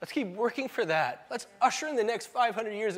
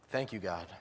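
soft, low prayer voice; closing prayer; 8 semitones lower, 13 dB quieter than usual. Acted. Pastor thanking God for communion, quiet prayer. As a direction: pray quietly and gratefully over the music.

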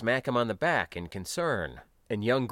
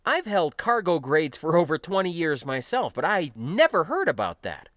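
higher, louder, amazed character voice; surprised; 7 semitones higher, 5 dB louder than usual. A narrator's reading. Veteran learning of an unknown system, surprised. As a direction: marvel at it, pitched up. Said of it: "clearly surprised".

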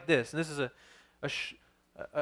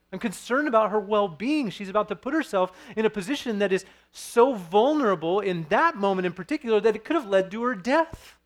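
forceful preaching; 5 semitones higher, 6 dB louder than usual. Acted. Pastor preaching to his congregation about Paul, forceful emphasis. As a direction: press each word home from the pulpit.

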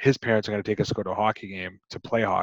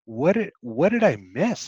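baffled; 7 semitones higher than usual. Retold or imitated. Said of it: "and I was like".

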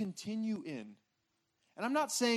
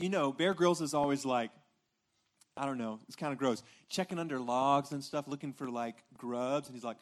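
ordinary talk

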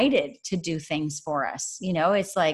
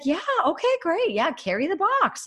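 much higher, bright cheering on; high, light encouraging voice of the Lord; cheerful encourager; 11 semitones higher than usual. Retold or imitated. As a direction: play a loving father cheering on a small child. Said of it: "He's like".

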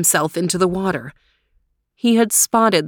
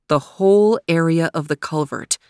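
ordinary delivery, nothing marked

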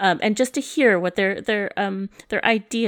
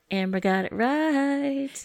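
"Amber Got It Right" announced slowly and a little higher, playing the chastened guest; mock formal correction; 7 semitones higher, slower than usual. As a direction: solemnly restate "Amber Got It Right" as a title. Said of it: "Oh, excuse me".

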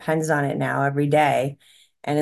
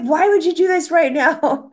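high, louder mock outburst at husband; re-enacting blowing up at the husband; exasperated outburst; 12 semitones higher, 6 dB louder than usual. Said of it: "I'm like".

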